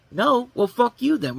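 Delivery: high, loud sneering brush-off; mock taunt. Filmmakers mocking the audience who won't laugh, loud high sneer. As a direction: jeer back at the audience as the filmmakers.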